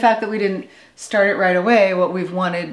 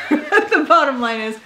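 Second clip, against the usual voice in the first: laughter bubbling through the words; laughing; 8 semitones higher than usual. Real, not acted. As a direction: restart the line still laughing. Restarting the line while laughing.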